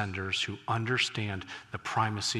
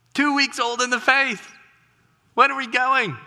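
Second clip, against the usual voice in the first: preaching shout; 6 dB louder than usual. Real, not acted. Preacher drives point home.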